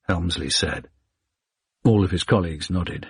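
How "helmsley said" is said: plain reading voice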